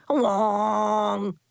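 loud blaring horn imitation; air horn noise. Air horn blast sound.

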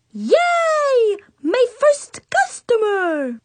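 put-on a kid hamming up delight at her first customer; cheering. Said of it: "Miss Bree Bree cheered".

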